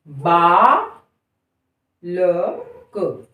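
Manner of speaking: "बालक" stretched extremely slowly, each sound held; extra-stretched demo. Shown as a demonstration. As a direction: hold every sound of "बालक". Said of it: "बोल कर देखिए". ("बालक" को बहुत लंबा खींचकर बोलना)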